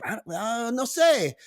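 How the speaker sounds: high, evasive mock Spanish accent. Wife dodging husband's question, in fake Spanish accent. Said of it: "And she's like".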